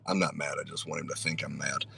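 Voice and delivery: low, soft reassurance; confiding aside. Retold or imitated. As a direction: drop the act and confide quietly. Confiding aside to Cam after mock anger.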